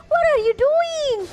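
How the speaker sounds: high, slow stunned cry; shocked disbelief. Real, not acted. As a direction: react in stunned disbelief at the play. Shocked caster reaction. (kaget tak percaya)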